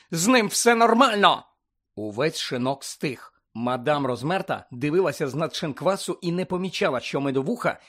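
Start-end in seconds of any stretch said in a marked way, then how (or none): 0.1-1.4 high, loud protest; angry shout. A narrator's reading. Harry insists Hagrid is fine, shouting. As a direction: shout it out in defence. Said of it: "закричав Гаррі".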